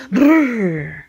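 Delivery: trilled shiver sound; shivering "brrr". A narrator's reading. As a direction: shudder out a brrr from the cold. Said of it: "Harry awoke and shivered".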